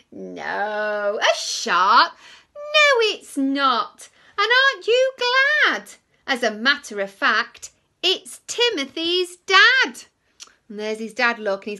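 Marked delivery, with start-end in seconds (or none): 0.1-10.0 high loud slow twist; gleeful reveal. Acted. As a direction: announce the happy ending. Happy reveal in the story.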